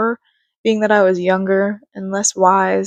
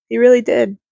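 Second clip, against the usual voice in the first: normal talking voice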